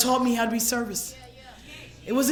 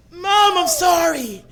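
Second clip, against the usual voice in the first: slow, higher mournful plea; grieving apology; 4 semitones higher, 4 dB louder, slower than usual. Retold or imitated. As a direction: draw it out as a sorrowful call to Mom. Apologizing to dead mother.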